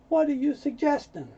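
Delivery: high-pitched offended retort. Acted. Man answering her advice to go back to school, offended. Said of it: "he's a little offended".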